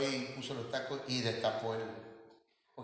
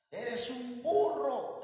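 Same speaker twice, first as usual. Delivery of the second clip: mock insult; 8 semitones higher, much slower than usual. Quoting verbal abuse to a spouse.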